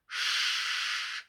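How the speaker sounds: a breathy unvoiced "sh" held on its own; demo sh sound. Shown as a demonstration. Teacher shows students the German sh, unvoiced. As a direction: hush out "sh" like a teacher showing the sound. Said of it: "put it over your lips as you say sh".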